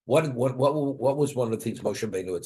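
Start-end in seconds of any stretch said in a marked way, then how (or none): none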